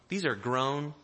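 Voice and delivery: ordinary delivery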